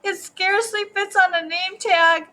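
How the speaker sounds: mock tearful whine, building to the end; crying child. A narrator's reading. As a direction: bawl it out like an upset little girl. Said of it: "I think she's crying".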